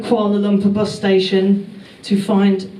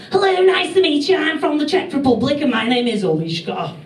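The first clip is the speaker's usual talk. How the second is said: put-on Czech kiddie with a Scouse accent; 6 semitones higher than usual. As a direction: recite beginner English in chirpy Scouse. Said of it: "I've got a Scouse mate".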